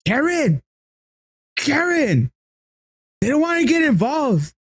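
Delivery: very high imitation of calling out to Karen; mock yelling. Stranger on the phone to Karen, high mock yell. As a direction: protest high-pitched, on the edge of laughing. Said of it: "he's yelling like".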